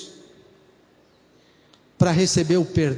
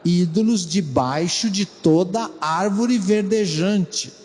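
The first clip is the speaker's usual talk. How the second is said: scripture reading; 4 semitones lower than usual. Shown as a demonstration. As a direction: read it again with weight. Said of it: "Vamos ler de novo".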